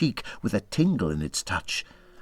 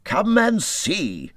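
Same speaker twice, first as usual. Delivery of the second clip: delighted; 9 semitones higher, much slower than usual. A narrator's reading. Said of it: "said the delighted rat".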